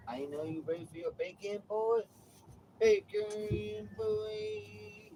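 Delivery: high cooing voice for the cat; pet talk. Acted. Baby-talking to his cat Romeo.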